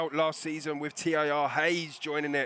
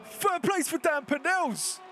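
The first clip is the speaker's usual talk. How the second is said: much higher, slow excited call; 11 semitones higher, slower than usual. Real, not acted. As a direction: shout the surprise result out. Surprise result on timing screen.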